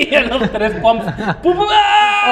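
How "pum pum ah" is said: high loud cry of pum pum ah; mock climax cry. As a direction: ham up a quick climax. Imita el clímax rápido. (grito de clímax exagerado, agudo y fuerte)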